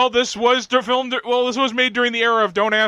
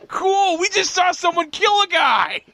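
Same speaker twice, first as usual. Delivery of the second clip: mock kid; 7 semitones higher than usual. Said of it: "look at the camera like".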